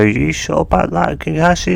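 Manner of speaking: lightly voiced cheeky Naruto. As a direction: tease the teacher as a cocky kid. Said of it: "As Naruto go".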